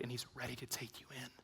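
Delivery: soft fast whisper of reassurance; hushed tender whisper. Acted. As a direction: breathe it like a secret promise.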